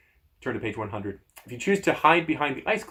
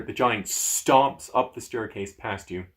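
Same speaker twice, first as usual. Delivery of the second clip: ordinary delivery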